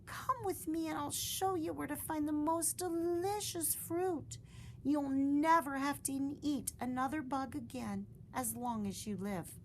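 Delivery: high, coaxing mother voice; high gentle promise; mother bat. Acted. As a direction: lure her with treats. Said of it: "said Mother Bat".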